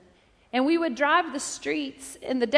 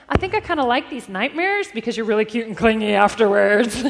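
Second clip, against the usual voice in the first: teasing with a laugh; 7 dB louder than usual. Retold or imitated.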